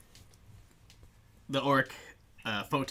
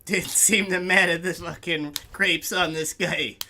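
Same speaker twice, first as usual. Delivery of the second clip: loud, high, mocking bouncer; suspicious orc bouncer; 6 semitones higher, 6 dB louder than usual. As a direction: sneer and press them, loud.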